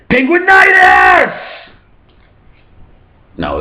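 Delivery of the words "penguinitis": high, loud drawn-out shout; battle cry. Acted. Penguins following their great leader, high mock-heroic battle shout. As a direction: shout it like an epic war cry.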